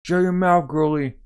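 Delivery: high, loud taunting threat; menacing snarl. Acted. Soldier threatening the captive girl, loud taunting snarl. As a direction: shut her up with contempt.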